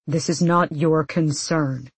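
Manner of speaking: ordinary delivery, nothing marked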